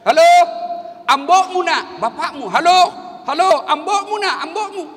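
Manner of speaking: loud, high calls of halo into the phone; shouting into phone. Retold or imitated. Old man yelling into a dead phone to his son. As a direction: yell down the line to reach his son. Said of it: "Langsung dia teriak".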